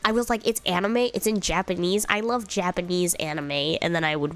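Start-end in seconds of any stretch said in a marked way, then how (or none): none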